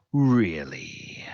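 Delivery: whispered Really, drawn out in contempt; disdainful whisper. Acted. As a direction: hiss the disbelief under your breath. Bazranik scoffs at Taskmaster.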